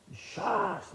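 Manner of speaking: hissed whip crack imitation; whip crack sound. Whip strike in joke.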